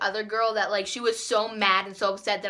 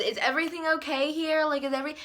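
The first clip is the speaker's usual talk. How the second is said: higher, lightly voiced concerned lunch lady; 6 semitones higher, faster than usual. Lunch lady checking on the arguing table, mild concern. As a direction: lightly imitate her checking in.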